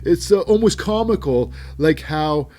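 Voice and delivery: Lashley gloating. Retold or imitated. Lashley laughing at Punk's injury, loud high gloating voice. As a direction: gloat over the injured rival. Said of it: "And he says".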